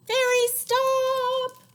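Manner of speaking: very high, loud, slow shriek; shrieking yell. Acted. Old woman screaming at the fairies to stop. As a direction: stretch out a desperate scream.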